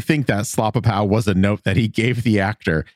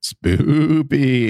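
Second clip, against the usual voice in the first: drawn-out, higher mock-spooky word; playful spooky voice; 5 semitones higher than usual. Co-host echoing the joke word, mock spooky. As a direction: stretch the "oo" like a ghost.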